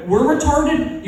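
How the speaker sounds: high-pitched incredulous comeback; indignant disbelief. Acted. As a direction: throw the insult back as a question. Acted indignant comeback to Boston fans.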